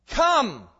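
loud, high, drawn-out COME; forceful one-word command; emphatic command. Shown as a demonstration. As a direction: order it like an imperative. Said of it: "it's quite the emphatic one".

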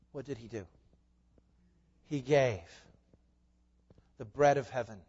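ordinary talk

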